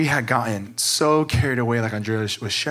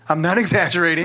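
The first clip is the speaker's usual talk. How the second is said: chuckling mid-sentence; laughing through it; 5 semitones higher, 4 dB louder, faster than usual. Real, not acted. Admitting the daily fights, laughing. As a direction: laugh as you insist it's true.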